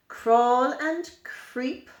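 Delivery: ordinary delivery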